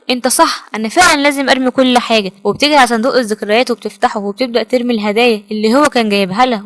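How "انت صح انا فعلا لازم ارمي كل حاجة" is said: ordinary delivery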